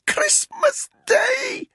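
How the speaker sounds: very high, slow gasped cry. A narrator's reading. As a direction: force it out on a choking breath. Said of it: "she gasped".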